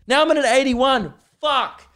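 high, loud burst of exasperation; getting angry. Real, not acted. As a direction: blurt it out as the reading keeps climbing. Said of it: "because I'm getting angry".

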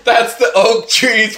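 ordinary talk, nothing marked

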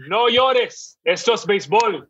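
high, Spanish movie line; Tom Hanks in Spanish. Retold or imitated. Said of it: "I'd like to hear Tom Hanks say that in Spanish".

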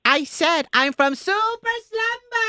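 high, bubbly put-on voice; party planner voice. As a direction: play an overexcited party planner. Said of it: "pretend that I'm a party planner".

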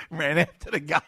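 ordinary delivery

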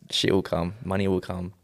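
usual speaking voice

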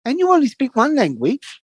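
high, slow boastful lecture; hammed-up proud immigrant man; mimicking the neighbour. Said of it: "He goes".

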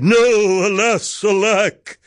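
high, melodramatic lament; hammed-up high cry of despair; anguished cry. A narrator's reading. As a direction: wail it like a lovelorn prince. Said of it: "The prince cried".

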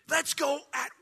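high, loud, stretched-out battle cry; rallying shout. Acted. Caleb calling the people to go at once, rallying shout. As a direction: rouse the crowd, punching the key words. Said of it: "And they look around and go".

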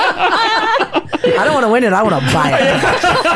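high, slow, laughing through words. Real, not acted. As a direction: giggle while saying it. Laughing about lottery home.

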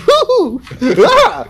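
loud, high whoop breaking into laughter; excited whoop. Retold or imitated. Musician re-enacts hearing the artist's name on radio, excited whoop. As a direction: whoop and squeal with delight. Acts out reaction to radio mention.

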